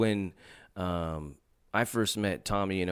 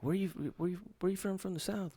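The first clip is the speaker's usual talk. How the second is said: imitating curious locals; 10 semitones higher, 8 dB quieter than usual. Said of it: "people be like".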